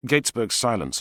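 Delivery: usual speaking voice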